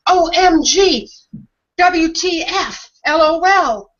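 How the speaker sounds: mock the shallow chat of acronyms; mock text-speak. Host mocking a future reduced to text acronyms, slow and high. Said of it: "we could be talking to each other with".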